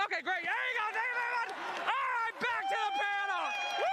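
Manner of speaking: high, excited wrap-up; hype host. Real, not acted. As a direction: cheer it back to the panel. Host wraps crowd segment.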